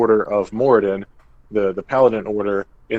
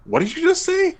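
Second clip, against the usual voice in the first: a squeaky stunned what did you just say; confused dwarf. Retold or imitated. Dwarf stunned by the party's perfect pronunciation, squeaking high. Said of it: "'Cause he's really confused".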